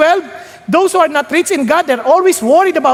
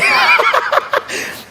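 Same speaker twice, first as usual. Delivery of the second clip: high airy laugh; 11 semitones higher than usual. Real, not acted. Laughing at his own joke.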